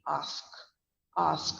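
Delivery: "Ask" as AHSK, open throaty vowel; long AH vowel. Shown as a demonstration. Teacher demonstrating ask for learners, long AH from the throat. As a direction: draw out the ah in "Ask". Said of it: "It's pronounced as A H S K".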